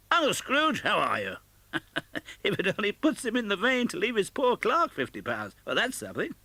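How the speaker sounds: put-on high, laughing young man's voice; merry nephew. A narrator's reading. Nephew telling friends about his uncle, merry and amused. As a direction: play the jolly nephew, full of fun.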